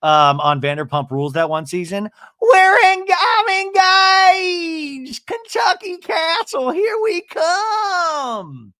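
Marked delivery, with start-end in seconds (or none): 2.4-8.7 wailing, very high hammed-up whoop; Brittany. Imitating a Vanderpump Rules engagement announcement, ecstatic mock wail. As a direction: stretch every phrase into a loud, high howl. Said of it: "It's kinda like Brittany".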